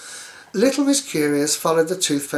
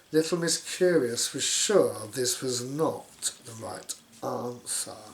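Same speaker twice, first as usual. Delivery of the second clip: ordinary delivery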